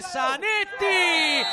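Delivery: long drawn-out shouted name; goal shout. Real, not acted. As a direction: yell the scorer's name, stretched. Goal call in live commentary.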